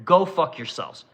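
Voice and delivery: usual speaking voice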